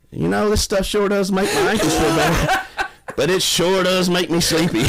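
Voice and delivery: laughing mimicry of the client raving about Benadryl; client's drowsy remark. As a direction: imitate the dozy client while cracking up. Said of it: "And she said".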